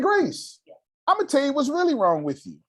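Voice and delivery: high, exasperated telling-off; impatient imitation of own scolding; retold confrontation. Re-enacting confronting Grace.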